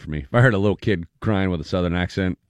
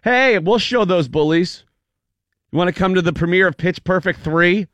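mock celebrity invite; 8 semitones higher, 4 dB louder than usual. Celebrities inviting the bullied kid to a premiere, high and showy. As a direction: dangle the premiere like a prize. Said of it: "to be like".

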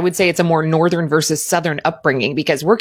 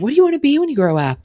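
high, quick sing-song asking; mock age-old question; 9 semitones higher, faster than usual. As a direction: toss it off like a grown-up quizzing a child. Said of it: "That age old question of".

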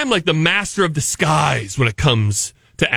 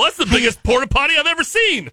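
retold amazement; 7 semitones higher, faster than usual. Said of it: "I'm like".

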